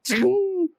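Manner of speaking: high bright chime sound; a sharp magical ping; mouth-made vanishing chime; magic ting. Acted.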